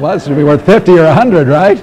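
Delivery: loud, hammed-up reckoning of big points; mock boastful expectation. Husband imagining his big reward, loud and mock-proud. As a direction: play the husband sure he earned a fortune in points. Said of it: "you think".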